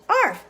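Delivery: high, short imitated arf; quick mock bark; dog barking. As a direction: yap it once like a dog. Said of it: "Dog says".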